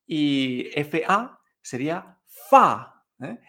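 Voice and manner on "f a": "fa" falling sharply; fourth tone. Shown as a demonstration. Teacher giving another example, "fa" sharply falling. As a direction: drop "fa" short and dry. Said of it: "De esta manera hacemos el cuarto tono".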